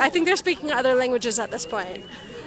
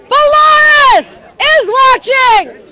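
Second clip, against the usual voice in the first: shouting; 12 semitones higher, 11 dB louder, slower than usual. Real, not acted. Calling out to distant crowd.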